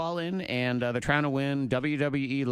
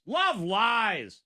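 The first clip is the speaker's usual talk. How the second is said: usual speaking voice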